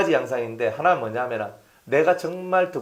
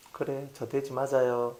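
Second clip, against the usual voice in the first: soft, slow, yielding reply; meek acceptance; 4 semitones lower, 9 dB quieter than usual. Acted. Pastor answers someone calling him a pig, meekly. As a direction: give in quietly and unhurriedly.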